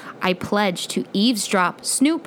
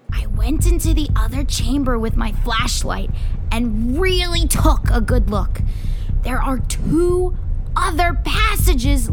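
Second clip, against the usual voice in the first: loud, raised storytelling, climbing higher; excited account; 6 semitones higher, 5 dB louder than usual. Acted. Boy tells friends of hidden cave passages, excited. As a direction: tell your big discovery with full energy.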